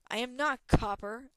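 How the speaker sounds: loud, offended correction; indignant retort. Acted. Dragon corrects Talon's insult, loud and offended. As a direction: bristle with wounded pride.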